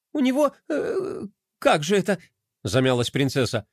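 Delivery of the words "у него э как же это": higher, groping then triumphant; hesitate, then recall it proudly; stumbling princess. A narrator's reading. Pinky remembers. (высоко: сперва мнётся, потом радостно вспоминает)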